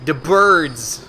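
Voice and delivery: loud dramatic horror-film cry; mock horror cry. Player joking about a horror crow with a loud dramatic cry. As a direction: ham up the horror movie line.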